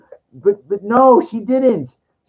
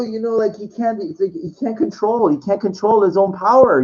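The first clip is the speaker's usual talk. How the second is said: ordinary delivery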